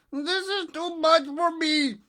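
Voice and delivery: high, loud, slow nasal groan; through his nose. A narrator's reading. Elephant's child straining against the crocodile, nasal. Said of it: "said through his nose".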